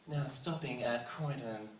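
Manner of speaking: slow, measured station announcement; train announcer. Retold or imitated. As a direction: read it like the recorded train voice. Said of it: "the voice that's like".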